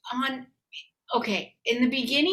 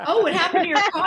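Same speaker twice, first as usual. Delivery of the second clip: high, louder, laughing question; mock concerned gasp; 7 semitones higher, 6 dB louder, much faster than usual. People noticing the dented car, mock surprised. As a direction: gasp at the dents, half amused.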